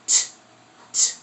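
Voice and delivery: crisp "t" consonant sound; sounding out words. Shown as a demonstration. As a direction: tap the "t" sound twice. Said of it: "And then the t".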